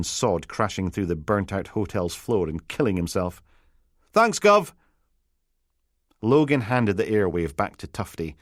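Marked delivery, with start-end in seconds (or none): 4.1-4.7 much higher, eager Peterhead constable; flustered Peterhead lass fumbling for the right title; a Peterhead constable calling her boss on the radio, flustered; Peterhead accent. Acted. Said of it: "A Peterhead accent".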